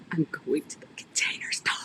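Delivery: breathless secret-sharing whisper; excited whisper. Retold or imitated. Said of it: "she was so excited".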